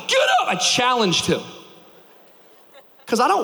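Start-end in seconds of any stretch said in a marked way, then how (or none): 0.1-0.5 high, loud barked order; commanding shout. Retold or imitated. Dad ordering his crying son to stand up, shouting. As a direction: snap him out of it with a shout. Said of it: "I said".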